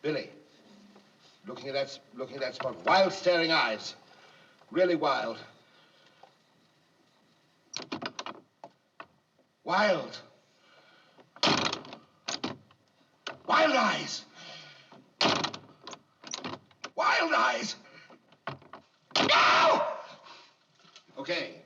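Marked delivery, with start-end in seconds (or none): 2.9-17.8 louder, slow urging calls; calling out. Real, not acted. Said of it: "director calls out during take". 19.3-19.8 very high, loud scream; piercing scream. Acted. Actor screams in scene.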